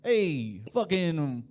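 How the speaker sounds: hammed-up Jersey Italian interjection; a bit of mock Jersey Italian swearing; Jersey Italian accent. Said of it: "trying to do more accents".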